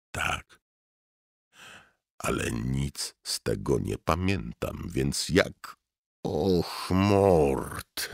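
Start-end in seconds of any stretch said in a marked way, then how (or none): 6.2-8.1 high, drawn-out wail. A narrator's reading. Inner voice wailing at Mort, high and drawn out. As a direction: wail his name like a mourner. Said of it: "zawodził głos".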